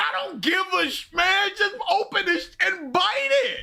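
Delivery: high-pitched, hurried exasperation; exasperated outburst. Real, not acted. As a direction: burst out impatiently at the video.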